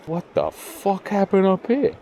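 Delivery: high, slow, drawn-out incredulity; baffled disbelief. Real, not acted. Angler finding a mess up here, baffled. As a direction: pitch leaps up, words stretched in bewilderment.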